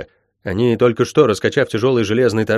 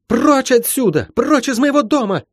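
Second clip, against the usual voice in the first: high, fierce cry of contempt; high-pitched furious order; hateful shout; 13 semitones higher than usual. A narrator's reading. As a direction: cry it out high, rolling with hatred. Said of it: "крикнула сама, вкладывая в слова собственную ненависть с презрением".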